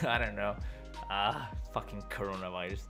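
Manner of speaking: normal talking voice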